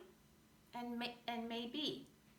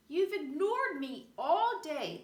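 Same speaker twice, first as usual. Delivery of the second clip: a hint of a complaining little bug, higher and louder; lightly voiced tiny bug; 5 semitones higher, 6 dB louder than usual. A narrator's reading. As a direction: whine it as a small bug left out all day. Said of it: "the tiny bug said".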